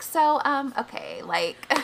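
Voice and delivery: lightly voiced ditzy Valley Girl. Shown as a demonstration. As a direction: drawl it out like a Clueless girl. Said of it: "doing it like Valley Girl talk".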